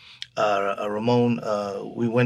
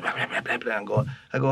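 ordinary talk, nothing marked

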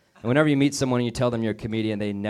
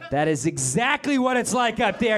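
shout; 10 semitones higher than usual. Real, not acted. Shouted punchline.

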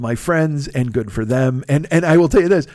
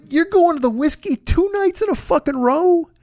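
much higher, disbelieving squeak; can't believe what he's hearing; incredulous; 13 semitones higher than usual. Retold or imitated. Quoting himself to his friend on the phone, high and incredulous.

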